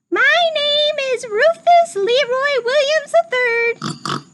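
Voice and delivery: high squeaky piglet voice, eager; little pig voice. Acted. Teacher reading Rufus introducing himself, high piglet voice.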